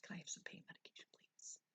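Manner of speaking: fast, soft whisper, begging politely; whispered plea. Retold or imitated. Patient in pain quietly begging the nurse for medication, whispered. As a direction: plead in a hurried whisper. Pleading for pain meds again.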